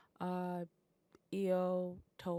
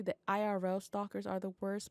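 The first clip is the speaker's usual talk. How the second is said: ordinary talk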